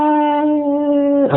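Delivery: high, drawn-out "what"; mock amazement. Caller learns host hails from Oakland, mock amazement. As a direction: stretch it out high in mock surprise.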